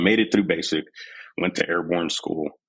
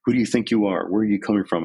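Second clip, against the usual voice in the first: yelling drill sergeant; 4 semitones higher than usual. Retold or imitated. Drill sergeant chewing out a recruit, mock yelling. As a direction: bark orders like a drill sergeant riding a recruit. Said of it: "I was like".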